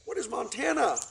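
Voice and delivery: chorus of curious cygnets; eager question. Acted. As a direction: ask it like curious kids. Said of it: "asked all the cygnets".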